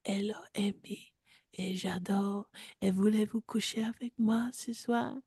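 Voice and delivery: soft breathy French come-on; hushed French pickup line; seductive whisper. Acted. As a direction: whisper it like a Parisian lover.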